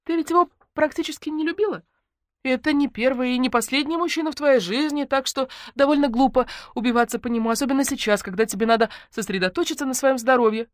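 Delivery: high, preachy consoling friend; high-voiced solemn condolence; doleful comforter. Voices Lenka's clumsy consoling.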